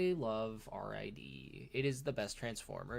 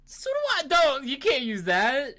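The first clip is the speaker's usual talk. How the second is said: much higher and louder, a playful outraged cry; mock outraged yell; 14 dB louder, slower than usual. Real, not acted. Answering his friend's anxiety excuse, a mock outraged yell. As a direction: shout him down in joking protest.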